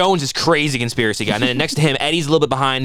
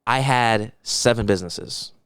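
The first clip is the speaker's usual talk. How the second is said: mock financial advisor; 3 semitones lower, slower than usual. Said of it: "financial advisors be like".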